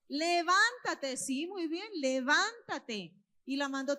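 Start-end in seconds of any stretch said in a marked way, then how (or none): 0.1-1.2 loud, raised, drawn-out command. Acted. Preacher echoing Jesus' order to the girl, loud slow command. 2.0-3.2 loud, stretched-out command. Acted. Preacher repeating Jesus' order to the girl, loud slow command.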